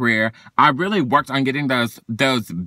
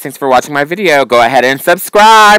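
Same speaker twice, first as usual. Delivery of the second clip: much louder, bubbly sign-off; loud outro; 2 semitones higher, 10 dB louder than usual. Real, not acted. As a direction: pump up the viewers, big and bright. Vlog outro to viewers.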